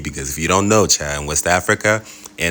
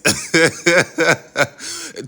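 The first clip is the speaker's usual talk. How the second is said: high laugh; 12 semitones higher, 3 dB louder than usual. Real, not acted. Laughing as power returns.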